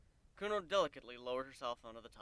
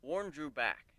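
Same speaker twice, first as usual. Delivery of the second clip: ordinary delivery, nothing marked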